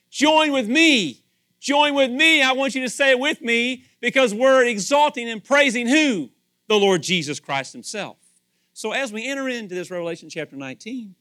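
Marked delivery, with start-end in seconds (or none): 0.1-8.2 louder, higher call to join in; rallying call. Pretend. Worshipper calling everyone to praise the Lord, loud and rousing. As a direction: rouse the crowd to praise. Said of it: "you're telling everybody else".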